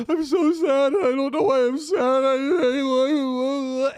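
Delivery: high, wailing imitation of sobbing; mock crying. Drunk friend crying in his uploaded video, retold as hammed-up sobbing. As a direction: blubber it out in exaggerated misery. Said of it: "and he's just crying".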